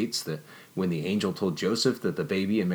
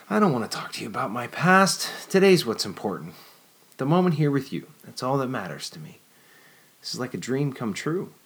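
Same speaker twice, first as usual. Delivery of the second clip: put-on lightly raised, earnest young woman; Jennifer's voice; 5 semitones higher than usual. Jennifer to Nicholas, a touch higher and earnest. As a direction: push the past away, stay in the moment.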